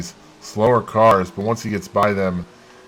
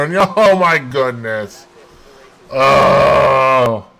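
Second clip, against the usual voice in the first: excited; 4 semitones higher, 6 dB louder, much slower than usual. Real, not acted. Chaotic last lap.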